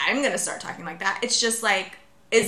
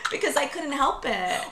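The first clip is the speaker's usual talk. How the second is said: ordinary talk, nothing marked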